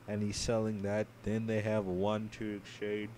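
normal talking voice

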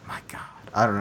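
exasperated under-breath whisper; whispered aside. Real, not acted. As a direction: mutter it to yourself in disbelief.

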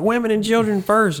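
very high, piping call-out; mock crew call. Crew calling to passengers on a burning ship, high mock call.

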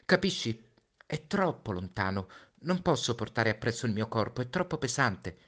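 put-on a boy bracing his friend for his death; Little Prince, pleading. Little Prince voiced in story. (Piccolo Principe, voce acuta e accorata)

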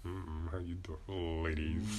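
low, slow sleazy pickup line; sleazy Mike voice. Pretend. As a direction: lay on the creepy charm. Horny Mike persona greeting.

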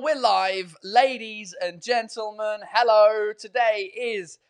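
loud, high announcer welcome; show announcer. Acted. Host welcomes viewers to his live show, announcer style. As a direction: boom the show open like a host.

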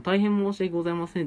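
imitating clerk apology. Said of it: "でもこの 「大変申し訳ございません」って言っても".

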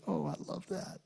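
soft, hushed Oh, I love that; doting dad charmed by his daughter; tender father. Retold or imitated. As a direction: melt over your child's mistake. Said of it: "my daddy heart that said".

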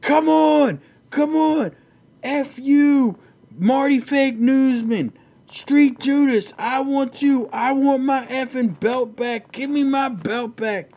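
hammed-up fighter screaming for his belt; mock trash talk yell. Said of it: "throw out his mouthpiece and say".

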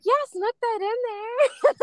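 high, drawn-out gleeful quote; stretched-out, high-pitched enthusiasm; excited mock cheer. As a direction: imitate a colleague cheering it on. Acting out colleague's excited reply.

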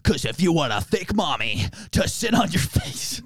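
sing-song bodybuilder catchphrase; big bodybuilder. Retold or imitated. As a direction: chant the rhyme like a hammed-up muscleman. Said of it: "that TikTok of the big bodybuilder".

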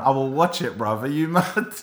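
usual speaking voice

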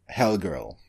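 ordinary talk, nothing marked

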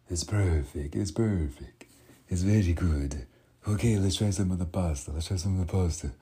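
a proud Italian chef tasting the pasta; Italian chef accent. Retold or imitated. Italian chef praising the food for his assistant, Italian accent. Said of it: "a guy with a heavy accent".

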